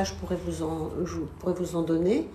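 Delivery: usual speaking voice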